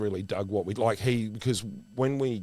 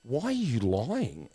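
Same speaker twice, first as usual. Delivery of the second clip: mocking; 3 semitones higher, slower than usual. Schoolgirls mocking the daughter.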